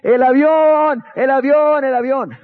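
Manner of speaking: high, loud ringing cry of the airplane; excited shout. Retold or imitated. Little bell-ringer announcing the plane to islanders, shouting. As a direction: yell it from the tower like the bell-ringer. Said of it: "y gritaba".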